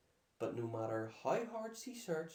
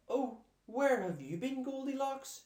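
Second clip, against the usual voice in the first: high, loud anxious mother's call; worried mother crying out; 11 semitones higher, 6 dB louder than usual. A narrator's reading. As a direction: call out like a relieved worried mum. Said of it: "she cried".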